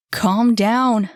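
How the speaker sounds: loud, firm order to calm down; loud command. Acted. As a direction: hold him back with your voice. Bellator holds him back.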